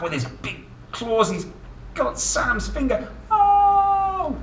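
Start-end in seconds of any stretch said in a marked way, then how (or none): 1.3-4.4 high, hammed-up cry of pretend pain; playful pretend yelp, very high; mock alarm. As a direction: squeal as if the crab pinched you. Crab grabs the finger in story.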